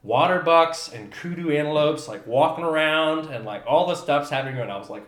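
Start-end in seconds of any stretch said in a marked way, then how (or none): none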